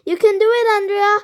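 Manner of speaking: high cheering shout; girl cheering. A narrator's reading. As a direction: cheer her on loudly. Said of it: "the girl yelled".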